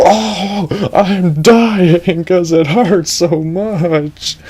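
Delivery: high, slow, strained moaning; dying victim. Acted. Victim impaled on Edge's spikes, high slow dying moan. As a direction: whimper out the pain, drawn out.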